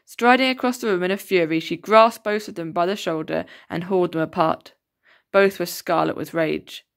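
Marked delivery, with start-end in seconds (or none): none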